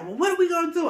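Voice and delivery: high-pitched mock fretting; squeaky, worried retold question; panicky friend. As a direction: imitate a friend starting to panic.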